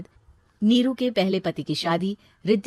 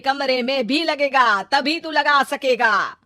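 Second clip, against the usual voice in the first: pushy relative laying down terms; scolding relative; 3 semitones higher, 7 dB louder than usual. Acted. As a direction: lean on her, insistent and a bit louder. (दबाव डालता रिश्तेदार)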